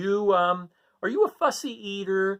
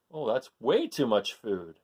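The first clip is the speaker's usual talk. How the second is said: ordinary delivery, nothing marked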